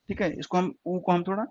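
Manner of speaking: usual speaking voice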